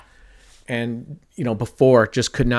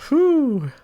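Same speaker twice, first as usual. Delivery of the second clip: high whew of relief. Real, not acted. Relief after the lucky win.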